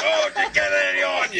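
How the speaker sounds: low, quick mock question; mimicking her. Man retells her wiping her face, low quick mimicry. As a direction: drop low and rush the quote. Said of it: "she's wiping her face off. Like".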